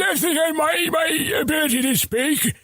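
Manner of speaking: very high, strained silly voice; silly character voice. Shown as a demonstration. Showing friends other voices, very high silly voice. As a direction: squeal it out indignantly, way up high. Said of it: "What other voices can you do?".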